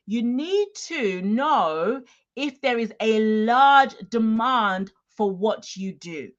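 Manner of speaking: "large demand" slowed and leaned on; emphasis on large demand. Shown as a demonstration. Coach stressing market size to online sellers, slow and weighted. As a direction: drag out "large" and "demand" to drive them home. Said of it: "I'm gonna emphasize the word large demand".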